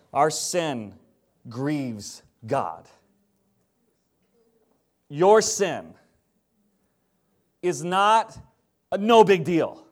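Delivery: usual speaking voice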